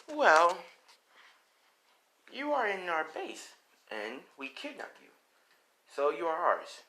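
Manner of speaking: high, playful captor voice; villain girl. Acted. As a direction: toy with the captive in a high voice. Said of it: "As though she's like".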